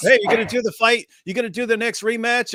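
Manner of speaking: high, brisk mock interviewer; reporter's question. Reporter pressing the boxer about a rematch, lightly voiced. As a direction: pitch it up like a chirpy reporter. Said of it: "he'll be like".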